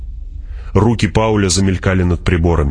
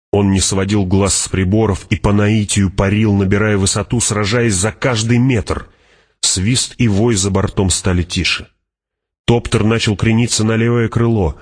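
ordinary delivery, nothing marked

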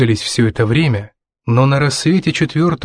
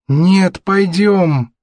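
higher, stubborn son's voice; whiny-kid insistence, pitched up; 7 semitones higher, much slower than usual. A narrator's reading. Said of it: "упрямо повторил Натан".

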